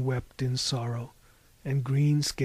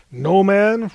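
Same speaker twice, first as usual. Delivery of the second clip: loud, higher, incredulous roar; roaring Cyclops; 5 semitones higher, 9 dB louder than usual. A narrator's reading. Cyclops answers Harvey's strange name, incredulous roar. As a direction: roar it back in disbelief. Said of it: "roared the Cyclops".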